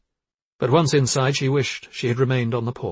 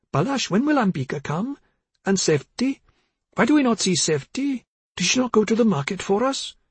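high, plaintive questioning; confused mother; 10 semitones higher than usual. A narrator's reading. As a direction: ask like a muddled mother, pitched well up.

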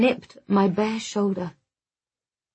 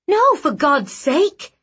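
strained outcry; 7 semitones higher, 5 dB louder, slower than usual. Acted. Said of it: "I could barely speak the words".

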